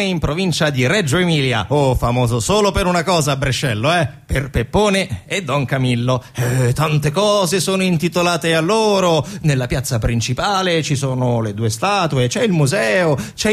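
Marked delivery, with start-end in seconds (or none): none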